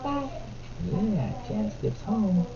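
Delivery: soft, hushed bedtime-story voice; quiet picture-book reading to a little one. Real, not acted. Reads picture book to toddler.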